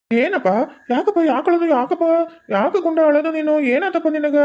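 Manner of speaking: hammed-up tender soothing; soothing the baby. Uncle fakes soothing crying baby.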